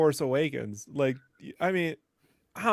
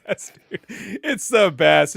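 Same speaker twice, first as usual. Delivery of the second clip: ordinary talk, nothing marked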